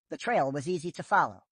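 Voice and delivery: plain reading voice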